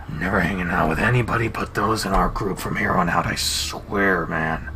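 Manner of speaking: low, muttered vow from the big wolf; lightly voiced wolf. Leo swears off strangers.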